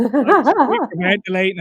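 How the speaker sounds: laughing through the words; real laugh breaking in. Real, not acted. Man laughing as he recalls hyperventilating before his idol. Laughing while retelling fanboying.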